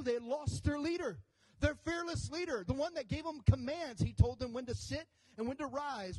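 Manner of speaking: fervent pulpit storytelling; preaching. Acted. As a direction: build the story for the congregation.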